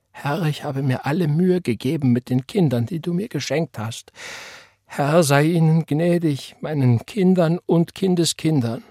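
high, thin tearful prayer; frail, breaking voice. A narrator's reading. Weeping mother prays for her children, voice brittle. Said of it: "Ihre Stimme wurde leise und brüchig".

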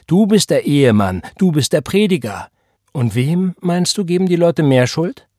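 high, loud, emphatic challenge; forceful, triumphant taunt; taunting accusation. A narrator's reading. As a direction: hammer home his titles, then challenge him. Mistress taunts the married preacher.

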